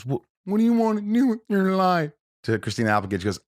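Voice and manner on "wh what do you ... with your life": quoting Farley. Retold or imitated. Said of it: "He says".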